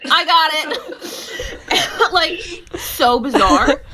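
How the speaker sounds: high, slow, laughing through the words. Real, not acted. Mother laughing to the host as she recalls catching the nuchal cord, laughing. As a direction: giggle in disbelief at yourself. Laughing at own midwife reflex.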